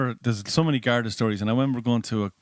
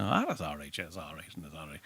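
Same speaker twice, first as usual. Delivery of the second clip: fading much softer and lower; retired cop shrug; 6 semitones lower, 9 dB quieter than usual. Retold or imitated. Retired cop taxi driver brushing off questions, fading soft. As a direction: shrug it off, trailing away.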